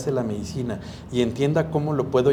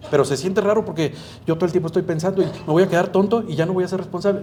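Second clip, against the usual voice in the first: higher, fast worried rush; anxious patient; 6 semitones higher, 3 dB louder, faster than usual. Retold or imitated. Patient voicing his worries to the doctor, fast and higher. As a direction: blurt the fears out quickly, pitched up.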